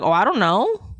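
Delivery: rising, mock-clueless I don't know; feigned innocence. Coaching a friend to dodge the stolen sign, mock innocence. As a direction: play dumb, lightly. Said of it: "you just gotta be like".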